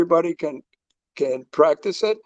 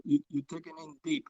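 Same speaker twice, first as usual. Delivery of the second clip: words spoken on an in-breath; talking while inhaling; 12 dB quieter, faster than usual. Shown as a demonstration. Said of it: "it sounds like this when you inhale".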